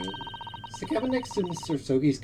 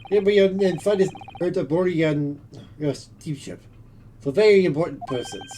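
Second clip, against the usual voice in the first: put-on Russian accent; 3 semitones higher, 4 dB louder than usual. Plays Russian sailor.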